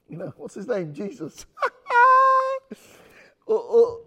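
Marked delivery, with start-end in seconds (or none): 1.5-2.7 high-pitched loud burst of laughter. Real, not acted. Laughing after own joke.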